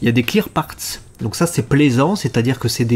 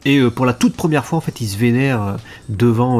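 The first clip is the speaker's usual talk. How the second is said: ordinary talk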